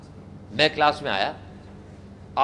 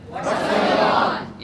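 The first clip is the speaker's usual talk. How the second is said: drawn-out, high, louder laughing greeting; 8 semitones higher, 5 dB louder, much slower than usual. Shown as a demonstration. Teacher greeting laughing students as he walks in. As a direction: stretch it out through a laugh. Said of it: "क्या बोलूँगा मैं?".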